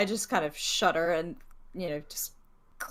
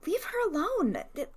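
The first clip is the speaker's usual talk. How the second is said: put-on child's outburst; 11 semitones higher, 4 dB louder than usual. Child defends mother.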